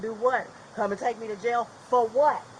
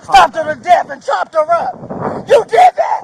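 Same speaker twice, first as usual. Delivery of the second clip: loud accusation rising to a high scream; outraged shouting; 5 semitones higher, 11 dB louder, slower than usual. Real, not acted. Accusing officers while detained.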